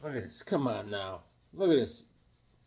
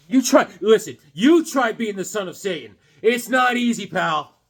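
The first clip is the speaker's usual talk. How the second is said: high, loud hammed-up indignation; upset protest; 6 semitones higher, 5 dB louder than usual. Answering for the upset son of Satan, indignant protest. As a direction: protest emphatically, in mock outrage. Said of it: "Hey, he's upset".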